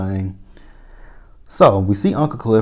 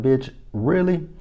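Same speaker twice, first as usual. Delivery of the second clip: incredulous; 6 semitones higher, slower than usual. Retold or imitated. Mercedes reacts to the singing.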